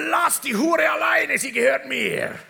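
loud translated demon shout. Retold or imitated. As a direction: echo the devil's roar. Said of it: "folgende sagt die Stimme".

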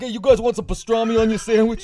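high, loud Italian-American counterman; Italian deli guy. Acted. Deli man offering ghouls a sandwich, cartoon Italian accent. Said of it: "it's just a weird fucking Italian at a deli".

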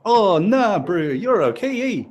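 lightly put-on Kiwi bro accent; a laid-back New Zealand bro voice, held lightly. A Kiwi bro talking easy, in a light put-on Kiwi accent. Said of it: "I think I'm losing the accent now".

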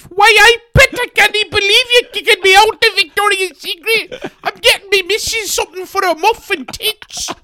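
very high, squawking comic protest; outraged Geordie customer. Acted. Geordie protests being kicked out of the shop, shrieking high. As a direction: screech indignantly in a hammed-up Geordie voice.

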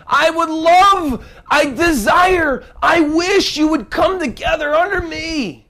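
high, loud pleading sermon voice; fervent preacher's cry; impassioned preaching. Acted. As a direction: preach it out like a plea. Preaching at full force.

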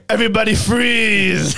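high, loud, slow villain bellow; over-the-top action-movie shout; Arnold. Acted. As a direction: stretch out a booming Austrian-accented command. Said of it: "My Arnold was terrible".

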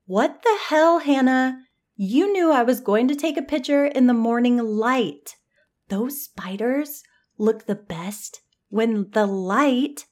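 high, loud angry outburst at Hannah. Acted. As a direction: snap at her like she stole your spider. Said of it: "She stomped over angrily".

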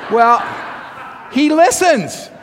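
deadpan joke delivered brightly; playful. Real, not acted. Joking about husband at sermon.

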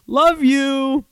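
high singsong farewell; mock-sweet sign-off. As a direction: chirp a fake-sweet goodbye up high. Acted cheery goodbye to gym mates.

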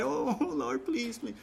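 high, soft mock begging; mock pleading cry. Mocking a worried believer's tearful plea to God. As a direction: play a half-hearted tearful plea. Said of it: "worry and cry".

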